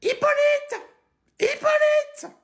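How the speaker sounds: a shrill-free high caricature lady, calling then chatting; high put-on woman's voice. Woman calls Hippolyte then says goodbye, high put-on voice.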